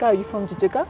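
usual speaking voice

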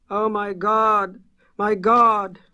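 high, loud, slow, choking on the words; choked-out terror. Acted. A fleeing farmer chokes out terror to the Arkham men. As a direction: gasp it out in panic. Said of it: "the voice choked out".

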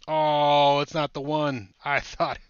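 high, loud burst of hype that deflates; excited outburst. Real, not acted. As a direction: shout it out, then catch yourself. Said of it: "I got you guys all amped up".

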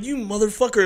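high laughing mock curse. Rapper voicing his reaction to his friend walking away. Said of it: "And I'm like".

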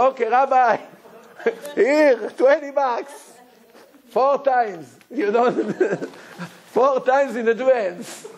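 amused man pays ahead; laughing congregant. Retold or imitated. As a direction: chuckle while handing over the money. Congregant paying in advance, laughing.

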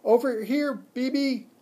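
high, loud squeaky call; small animal calling out high and loud; squeaked at top of lungs. A narrator's reading. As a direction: squeak out to get her attention. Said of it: "squeaked at the top of my lungs".

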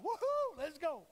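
high, loud excited whoop. Retold or imitated. Peter cheering as he leaps from the boat, excited. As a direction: cheer like a thrilled Peter jumping out. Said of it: "Peter's like".

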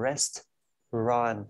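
"Restaurant" slowly as two beats, rest-ront; two-syllable demo. Shown as a demonstration. Teacher showing two syllables, slow demo. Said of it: "hai âm đấy nhá".

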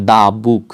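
the book, "the" said "daa". Shown as a demonstration. Teacher modelling the article before a consonant, "daa book". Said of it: "তাহলে কি হবে?".

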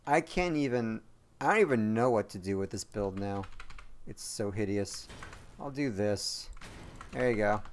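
ordinary talk

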